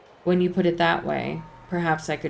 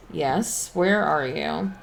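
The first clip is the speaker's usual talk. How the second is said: usual speaking voice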